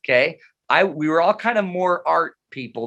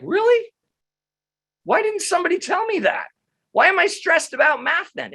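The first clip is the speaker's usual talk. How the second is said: much higher, sarcastic disbelief; mock surprise; 14 semitones higher than usual. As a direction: play up the fake shock, way up high. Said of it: "And I'm like".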